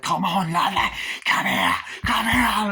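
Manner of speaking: loud, high acted yelling; menacing shouting. Gang calling after the woman, menacing shout. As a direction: holler it at someone getting away.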